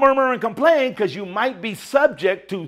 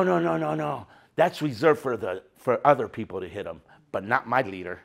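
low, soft, rebuking God; stern God voice; 9 semitones lower, 9 dB quieter than usual. Retold or imitated. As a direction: speak as God, low and quietly reproachful. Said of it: "probably God said".

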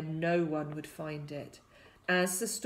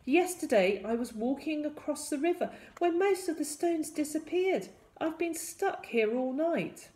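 put-on higher-pitched stranded boy; distressed boy; 8 semitones higher than usual. A narrator's reading. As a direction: plead your plight in a raised voice. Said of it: "the boy cried".

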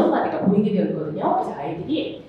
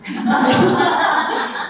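high bursting laugh; 4 semitones higher, 6 dB louder than usual. Real, not acted. Laughs at her own joke.

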